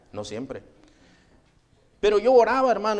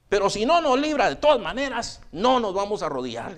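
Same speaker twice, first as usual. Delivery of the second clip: raised, firm defiance, acted out; defiant refusal; 5 semitones higher than usual. Three believers to the king, defiant. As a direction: stand up to a tyrant.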